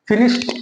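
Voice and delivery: फिनिश्ड rising, with a T ending; correct pronunciation. Shown as a demonstration. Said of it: "इस तरीके से बोलेंगे". (फिनिश्ड में 'ड' की जगह 'ट')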